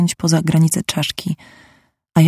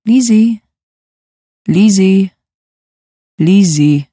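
a woman calling Lizzie from over the fence, louder; repeated calling, louder then settling; calling out a name; 3 semitones higher, 4 dB louder, slower than usual. Acted. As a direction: call her name across the yard. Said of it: "kobiecy głos woła mnie po imieniu".

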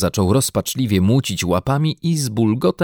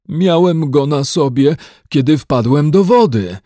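lamenting; 7 semitones higher, 6 dB louder than usual. A narrator's reading. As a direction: mourn the lost hat. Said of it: "lamentował Paddington". (lament misia)

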